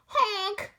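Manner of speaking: high honk like a goose. Acted. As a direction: squawk it out like a goose. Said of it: "went a goose".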